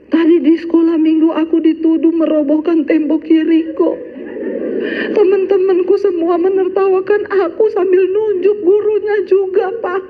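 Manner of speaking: put-on high child's voice, near tears; crying child. Yosua tells his father about school, high whiny child voice. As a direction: play the hurt little boy. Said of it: "ada anak nangis".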